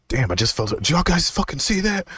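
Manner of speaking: high, amped-up did y'all see that; excited outburst. Retold or imitated. Telling friends what he saw, high and excited. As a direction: blurt it out buzzing.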